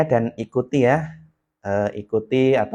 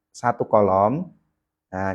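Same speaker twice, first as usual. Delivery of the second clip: ordinary talk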